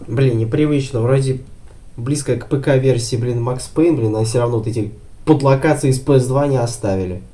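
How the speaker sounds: excited. Real, not acted. Streamer chatting over game, excited.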